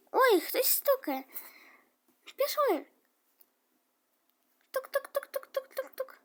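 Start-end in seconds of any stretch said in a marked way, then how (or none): 4.7-6.2 high hushed knocking made with the mouth; a soft high tapping knock; soft knock sound. Pretend. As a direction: knock quietly with your voice. Knocking at the door again.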